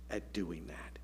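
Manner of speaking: whispered question. Real, not acted. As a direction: drop to a whisper to draw them in. Sermon question dropping to whisper.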